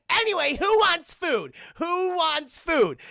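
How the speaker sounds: loud, high call to the group; vampire leader shouting to his gang about food; cheery shout. Retold or imitated. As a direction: bellow it like a party host.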